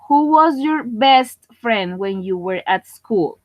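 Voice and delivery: slow, deliberate English question, every word spelled out clearly; careful pronunciation demo. Shown as a demonstration. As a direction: read it slowly like a teacher modelling who. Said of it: "esto se lee who". (pregunta en inglés lenta y bien pronunciada)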